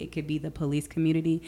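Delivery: normal talking voice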